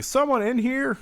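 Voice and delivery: high, questioning call; mock calling out. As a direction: imitate calling into a barn. Acting out calling into the barn.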